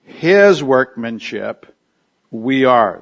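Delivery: HIS drawn out slowly, leaning on it; emphatic HIS. Shown as a demonstration. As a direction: put weight on "His" at the front, slow and deliberate. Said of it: "renders it emphatic".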